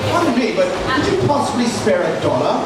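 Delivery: put-on lightly done posh English accent, polite; a hint of an English accent asking politely. Comic asks a stranger for a dollar in a light English accent. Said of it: "if somebody comes up to me and says".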